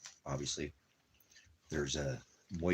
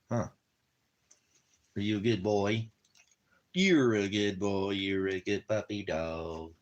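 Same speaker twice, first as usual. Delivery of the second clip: higher, slow cooing praise; doggy talk; 3 semitones higher, 4 dB louder, slower than usual. Real, not acted. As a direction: tell the puppy he's good, tenderly. Praising his dog.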